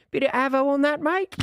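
high put-on Aussie accent; Australian accent. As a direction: ask it as a high-pitched imitation Australian. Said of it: "Can you say more than just Big Ange?".

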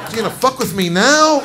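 exasperated retort. Retold or imitated. Comic snaps back at wife.

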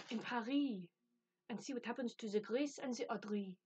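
playful, badly faked French accent. Teasing viewers about reading in Paris, mock French accent. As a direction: put on an amateur French accent. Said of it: "my French accent is not brilliant".

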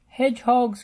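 loud, high alarmed cry; cried out. A narrator's reading. Bob cries out at the hedgehogs, alarmed. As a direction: yell the warning. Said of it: "cried Bob".

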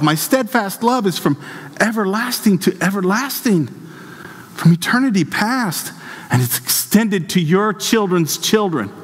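high-pitched, insistent declamation; impassioned preaching. Acted. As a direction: speak as God in a high, fervent pulpit voice. Preaches in God's voice.

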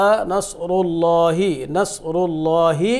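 slow recitation. Shown as a demonstration. Slow recitation model for students.

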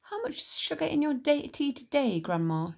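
put-on a polite boy asking his grandma; boy George. George's voice in the story.

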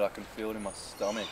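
ordinary talk, nothing marked

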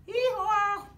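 loud high hee-haw bray; donkey bray. Acted. Reader braying as the donkey, loud and high.

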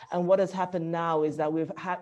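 ordinary delivery

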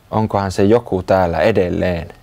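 usual speaking voice

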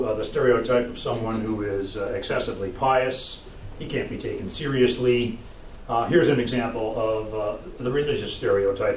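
ordinary delivery, nothing marked

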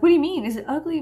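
high, loud worried questions; retold panicky questioning, starting loud; scared. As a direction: act out alarmed self-doubt. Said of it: "I was so scared".

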